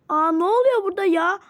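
loud, high surprised question; startled. Acted. Friend walks into the fight, loudly surprised. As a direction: burst in shocked at the commotion. Arriving into the quarrel.

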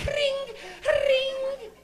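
high shouted ring ring imitating a bell; mock bike bell. Mocking cops on bikes, high shouted bell sound. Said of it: "their bike".

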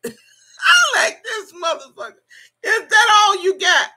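high, loud laughing taunt. Real, not acted. Laughing loud and high at the critic's weak insult. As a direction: crack up while taunting the critic.

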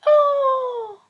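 high delighted "Ah"; a joyful high exclamation; delighted cry. A narrator's reading. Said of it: "said the scholar".

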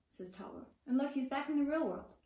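plain reading voice

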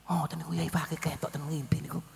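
hushed unvoiced confiding; whisper. Preacher whispering the habib's confidence about a dream vision. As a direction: tell it like a secret. Retold secret dream story.

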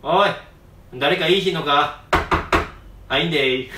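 a rough Kansai lad, laid on thick; Heiji impression. Mimicking Heiji at the Yokohama university scene in heavy Kansai dialect. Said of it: "いきますね、モノマネ".

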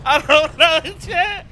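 high, helpless voice between laughs; cracking up, pitch climbing; barely holding in real laughter; laughing to tears. Real, not acted. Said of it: "I'm crying".

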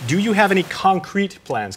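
"concrete" punched, high and slow; stress on concrete. Retold or imitated. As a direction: read it out slowly, hammering the word "concrete".

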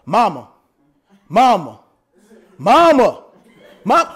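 low, drawn-out calls for mom; child calling Mama. Retold or imitated. Preacher imitating his nephew calling for his mother, low and repeated. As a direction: call mom over and over like a kid. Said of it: "One thing that he been doing since he was a child".

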